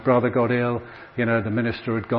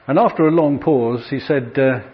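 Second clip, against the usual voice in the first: ordinary delivery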